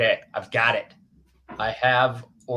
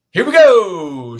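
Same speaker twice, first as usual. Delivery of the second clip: loud, high, drawn-out call; playful announcing call; 6 semitones higher, 13 dB louder, much slower than usual. Real, not acted. Host launching the screen share for the reader, playful loud call. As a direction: sing it out long and loud. Host calls out as sharing starts.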